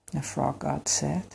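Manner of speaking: ordinary delivery, nothing marked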